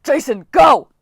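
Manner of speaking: loud, high urgent yell. Acted. Coach urging Jason to jump, loud yell. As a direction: yell the order hard. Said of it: "Hedge yelled".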